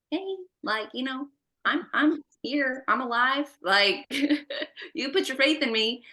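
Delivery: a light, informal take on a reminder; lightly voiced Jesus. Jesus reminding a worried believer he is here, lightly voiced. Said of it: "And Jesus is just like".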